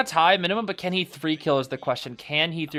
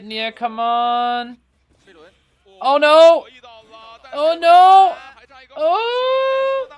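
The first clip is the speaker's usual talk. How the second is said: high, loud despairing oh no; real panic as the player gets hit; anguished cry; 11 semitones higher, 12 dB louder, much slower than usual. Real, not acted. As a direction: wail at the screen as it goes wrong. Tense match, player in danger.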